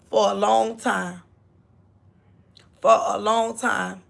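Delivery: normal talking voice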